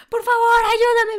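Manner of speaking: high, loud cry for help; terrified retold plea to the pilot; panicked plea. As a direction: beg him to save you.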